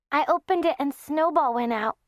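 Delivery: put-on a sleepy four-year-old; little girl. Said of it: "Margaret said".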